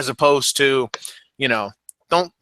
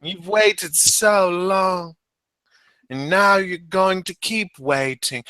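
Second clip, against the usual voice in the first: very slow, higher imitation of her Australian promo; mock Australian accent; 4 semitones higher, much slower than usual. Host parodying Emma's promo, slow, mock Australian accent. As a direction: drag the Australian vowels out like a dramatic promo. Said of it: "Australia style".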